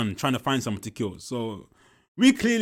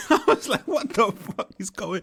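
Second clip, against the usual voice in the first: laughing disbelief; 7 semitones higher, 3 dB louder than usual. Real, not acted.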